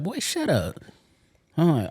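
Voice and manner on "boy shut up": lightly voiced ex, higher and dismissive; imitating his ex. Retelling what his ex told him, higher mock voice. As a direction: brush him off in her voice, pitched up.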